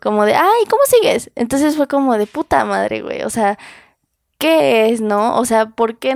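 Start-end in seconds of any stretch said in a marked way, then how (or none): 0.4-1.3 sing-song sugary high "cómo sigues", mocking; mock sweet concern. Mocking the ex who checks in late, in a high syrupy voice. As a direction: lay on fake sweetness, pitched way up.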